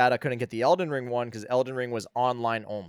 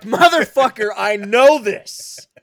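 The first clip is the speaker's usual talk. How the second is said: much higher, much louder and slow; exasperated outburst; 9 semitones higher, 13 dB louder, slower than usual. Retold or imitated. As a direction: blow up in disbelief, dragging it out. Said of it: "and I'm like".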